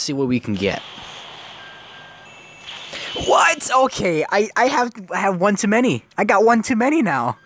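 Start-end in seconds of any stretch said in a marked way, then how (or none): 3.3-7.4 higher-pitched excited disbelief; surprised. Real, not acted. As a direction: babble it out amazed. Surprise at duplicate summon.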